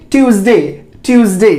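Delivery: ट्यूसडे said as "tyooz-day" with Z; correct pronunciation demo. Shown as a demonstration. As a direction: model ट्यूसडे twice with a Z. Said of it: "इसको सही तरीके से बोलिए". (ट्यूसडे में ज़ की ध्वनि)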